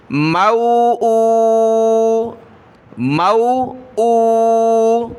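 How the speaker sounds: measured sing-song letter-by-letter recitation; slow classroom recitation drill; Qaida spelling drill. Shown as a demonstration. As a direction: chant each syllable, then blend and stretch the word.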